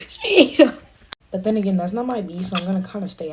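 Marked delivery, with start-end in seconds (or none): 0.1-0.8 loud, high, laughing cheer; excited laughing cheer. Real, not acted. Cheering the clapback.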